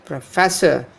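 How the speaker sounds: professor as pruh-FESS-uh; stress on second syllable. Shown as a demonstration. Teacher giving the right form, professor as pro-FESS-or. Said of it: "Not professor".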